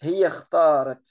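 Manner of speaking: hiya khtarat, no i before khtarat; silent i. Shown as a demonstration. Teacher shows students the dropped i. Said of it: "et je ne prononce pas le i, vous l'avez bien entendu".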